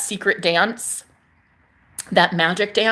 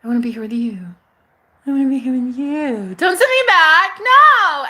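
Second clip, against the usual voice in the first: retold begging to stay, rising to a cry; desperate pleading building to a cry; 4 semitones higher than usual. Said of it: "by saying".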